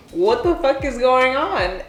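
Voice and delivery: shocked disbelief. Retold or imitated. Retold shock at abusive scene.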